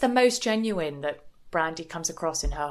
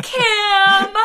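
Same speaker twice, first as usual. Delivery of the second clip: much higher, louder wail of the name; dramatic cry; 13 semitones higher, 9 dB louder than usual. Retold or imitated. Podcaster plays the friend crying back to Kim, high and loud. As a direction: wail the name in mock anguish.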